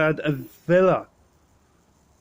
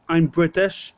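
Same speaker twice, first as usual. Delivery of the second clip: ordinary talk, nothing marked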